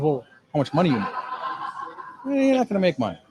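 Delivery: a hint of the wife's choppy English; imitating Korean wife. Husband mimics his wife grilling him about money, lightly accented. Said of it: "I love the way he leaves all the, the vowels out".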